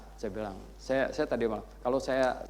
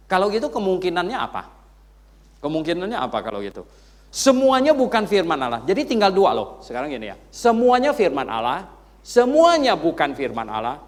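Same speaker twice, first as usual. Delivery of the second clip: loud preacher pressing the question; preaching at full force; 5 semitones higher, 11 dB louder than usual. Acted. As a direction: drive the point to the crowd. Berkhotbah dengan semangat penuh. (khotbah lantang dan tegas)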